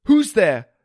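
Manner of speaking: a sudden loud high cry into the dark; startled. Acted. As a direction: jump at the voice and call out. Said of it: "Startled, I asked".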